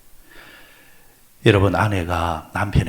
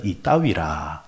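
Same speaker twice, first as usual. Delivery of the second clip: 이따위라 drawn out slowly in Jeolla dialect; 3 semitones lower, much slower than usual. Shown as a demonstration. Pastor contrasting the Jeolla way, slow Jeolla dialect. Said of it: "전라도 사람들은".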